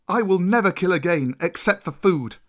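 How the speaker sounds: insistent protest; firm refusal. Acted. Mowgli refuses to kill.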